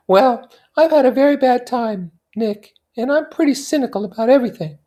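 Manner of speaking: put-on high, light young society woman; Daisy's voice. Daisy confiding in her cousin Nick, high and light. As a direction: lift into a bright, high feminine voice.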